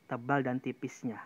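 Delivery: usual speaking voice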